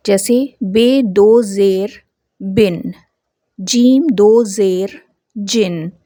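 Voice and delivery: slow, syllable by syllable, closing on बिन and जिन with a clear n; slow Urdu spelling drill, tanween read as बिन and जिन. Shown as a demonstration. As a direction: spell out दो ज़ेर slowly, then say बिन with the nun sound. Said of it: "ये दो ज़ेर होते हैं".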